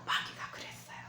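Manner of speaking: breathy hushed aside; whisper. Acted. Pastor whispering to the congregation who said it. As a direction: whisper it like a secret.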